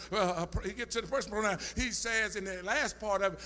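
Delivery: normal talking voice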